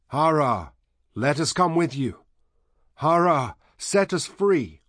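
high, loud pleading cries; the crowd of the dead calling out to be freed. A narrator's reading. Shadowy dead calling Hara across the wall, pleading cries. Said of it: "called him by his name".

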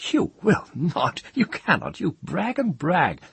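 very high, scornful outburst; sudden rage. A narrator's reading. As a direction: let rage flare through the disdain. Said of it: "rage suddenly flaming out over his disdain".

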